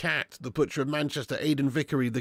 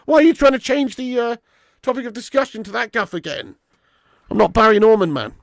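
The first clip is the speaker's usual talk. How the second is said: mock rant; 8 semitones higher, 10 dB louder than usual. Heated rant at chat member.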